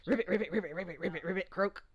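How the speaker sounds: loud excited ribbiting frog. Acted. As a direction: hop and croak it out loudly, like a giddy frog.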